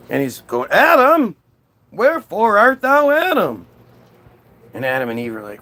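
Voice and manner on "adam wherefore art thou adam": much higher, louder theatrical call; insistent calling out. Acted. God cannot find hidden Adam, calling out insistently. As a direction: bellow the name as a searching God.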